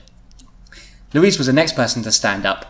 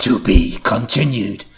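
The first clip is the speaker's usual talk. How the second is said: slow, lower cliffhanger voice; cliffhanger sign-off; 4 semitones lower, slower than usual. Acted. Reader ends on a cliffhanger, slow dramatic sign-off. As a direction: draw it out ominously to close the episode. Last line of story cliffhanger.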